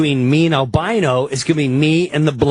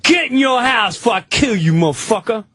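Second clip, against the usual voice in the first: threatening the speaker; 6 semitones higher than usual. Retold or imitated.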